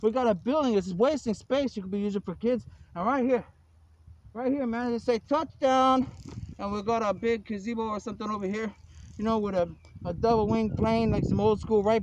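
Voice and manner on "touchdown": stretched, slightly raised call of a touchdown; mock touchdown call. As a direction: call it out like a score, lightly. Quoting a called-out touchdown.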